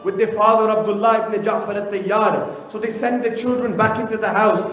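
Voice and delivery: fervent recitation swelling into a grieving cry; impassioned masaib recitation. Acted. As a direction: lament the story with rising grief and force.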